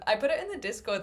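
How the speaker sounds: usual speaking voice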